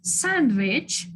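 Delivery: Sandwich with the first syllable stressed and drawn out. Shown as a demonstration. Teacher showing where stress falls, Sandwich stressed on the first syllable. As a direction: lean on the SAND in Sandwich. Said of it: "Биринчиге түшүп атат".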